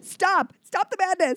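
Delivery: high-pitched, hammed-up plea to stop; mock-exasperated demand. Actor telling agents off over hair color, exasperated. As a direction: put a dramatic end to the nonsense.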